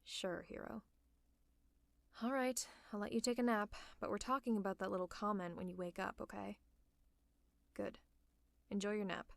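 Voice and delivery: hushed, soothing bedside voice; gentle bedside concern. Acted. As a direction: talk gently to a sick friend. Tending to feverish, sleepy hero.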